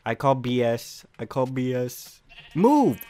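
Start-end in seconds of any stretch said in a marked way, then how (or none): 2.6-3.0 short, loud, high shout; exasperated yell. Real, not acted. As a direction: yell it at whatever blocks you. Yelling at obstacle in game.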